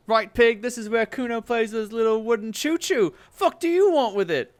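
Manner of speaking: high, loud snapping brat; a kid guarding his turf; bratty kid Kuno. Acted. Kid snaps at the cop, high and loud.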